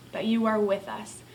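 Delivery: usual speaking voice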